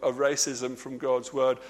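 usual speaking voice

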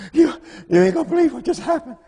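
gasp, then a high, shaken rush of words; panicked gasping. Retold or imitated. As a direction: sound like you can barely breathe from fright. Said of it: "She called me up hyperventilating".

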